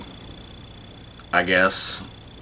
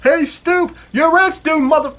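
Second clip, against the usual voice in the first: mock yell; 4 dB louder, slower than usual. Quoting a character yelling at tenant.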